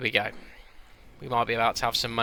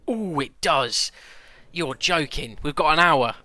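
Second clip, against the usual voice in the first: surprised; 4 semitones higher, 5 dB louder, slower than usual. Real, not acted. Deal unexpectedly goes through.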